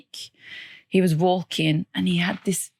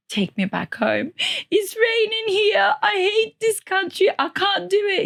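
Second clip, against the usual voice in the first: crying to dad; 14 semitones higher than usual. Retold or imitated. Young student on the phone crying to her dad to come home. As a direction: beg through sobs to go home. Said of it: "I called my dad and I'm crying".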